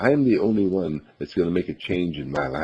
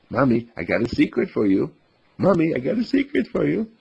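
put-on excited child; 10 semitones higher than usual. Child telling mom a secret.

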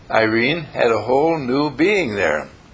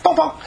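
mock bonk; 14 semitones higher than usual. Mock giving a shot.

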